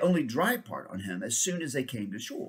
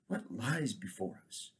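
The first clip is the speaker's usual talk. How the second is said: softer and slow; king leans on friend, speaks low; low grieving voice; 3 semitones lower, 10 dB quieter than usual. Acted. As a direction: murmur it with bowed head. King grieving, bowed head.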